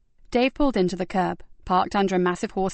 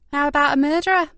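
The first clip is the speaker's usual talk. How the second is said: a darkly pointed question, higher and louder; 7 semitones higher, 4 dB louder than usual. A narrator's reading. Constable hinting at murder to her partner, darkly. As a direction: ask it darkly, half teasing. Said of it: "asked Yvonne darkly".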